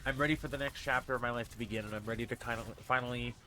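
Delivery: ordinary talk, nothing marked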